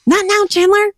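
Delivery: high, clipped Monica voice; Monica impression. Shown as a demonstration. Host showing off her Monica voice to Tom. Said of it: "That's my Monica impression".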